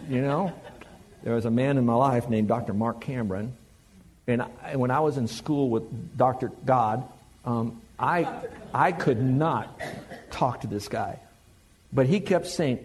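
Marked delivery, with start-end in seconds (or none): none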